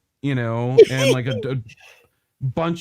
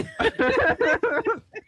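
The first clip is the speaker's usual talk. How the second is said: ostrich squawk. Shown as a demonstration. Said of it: "ostrich impression".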